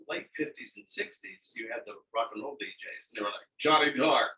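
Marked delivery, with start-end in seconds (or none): 3.6-4.3 loud, high, booming DJ announcer; radio DJ voice. Retold or imitated. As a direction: hype it like a sixties rock and roll DJ. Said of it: "And they all talk like this".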